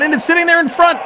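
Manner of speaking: excited call. Real, not acted. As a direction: jump up as the puck sits in front. Chance in front of net.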